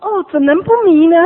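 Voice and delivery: very loud, high acted punchline; hammed-up, irresistible enthusiasm; exuberant exclamation. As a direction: cry out like a thrilled new convert. Acts convert's delighted punchline.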